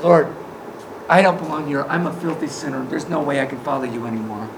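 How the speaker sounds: low, soft admission of unworthiness; hushed confession. Acted. Peter confessing to Jesus that he is unworthy, hushed.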